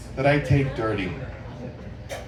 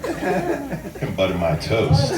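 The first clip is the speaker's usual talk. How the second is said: very slow, drawn-out "toast" with a laugh; sexy tone; 3 dB quieter, much slower than usual. Shown as a demonstration. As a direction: stretch it slow and suggestive. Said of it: "make it sound sexy by the tone of your voice".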